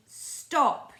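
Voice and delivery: sharp, loud, high cry of Stop; a strict teacher's sudden furious shout. Retold or imitated. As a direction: yell it at the pupil to cut her off. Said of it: "she shouted".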